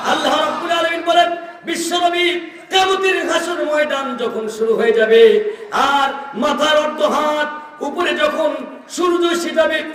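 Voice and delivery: high, stretched sermon cry; fervent preaching. Real, not acted. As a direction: chant the warning out high. Preaches about judgment day.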